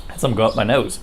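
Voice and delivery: talking around a pipe stem; pipe in mouth. Real, not acted. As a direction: pipe in mouth after relighting. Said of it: "let me relight my pipe".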